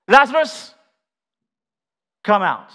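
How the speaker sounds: high, loud command to the dead; commanding shout. Acted. Jesus commanding Lazarus from the grave, shouting. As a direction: shout it out as a summons. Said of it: "He shouted".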